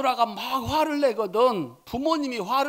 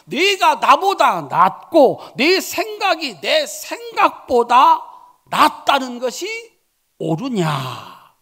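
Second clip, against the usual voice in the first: God rebuking; 5 semitones higher, 9 dB louder than usual. Acted. God voiced rebuking Jonah.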